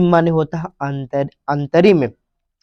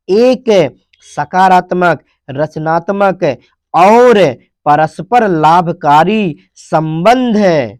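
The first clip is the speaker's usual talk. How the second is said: slow explaining; 3 dB louder, slower than usual. Shown as a demonstration. Teacher repeats translation slowly.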